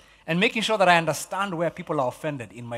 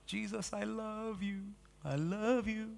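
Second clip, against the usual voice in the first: much softer, higher, slow and earnest; pleading Peter; 4 semitones higher, 13 dB quieter, slower than usual. Retold or imitated. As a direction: breathe it out like an earnest disciple. Peter voiced pleading love.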